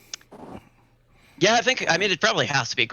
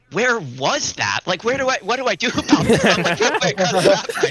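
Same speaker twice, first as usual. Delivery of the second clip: high-pitched baffled protest breaking into laughter; bewildered, pitch climbing as it goes, laughing by the end; retold bewilderment; 7 semitones higher, 4 dB louder than usual. Player recalling his reaction to a wild shatter, comically baffled. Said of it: "and I'll be like".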